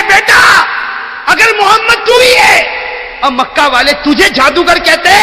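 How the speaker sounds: high weeping sermon cry; tearful preaching shout. Acted. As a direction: wail the mother's prayer out to the crowd. Old mother's tearful prayer, shouted.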